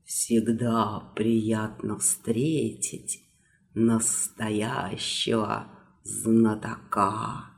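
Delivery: low, soft, slow wet rustle; rustling voice. Acted. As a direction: whisper it like damp mohair. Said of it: "влажно прошелестел махеровый голос".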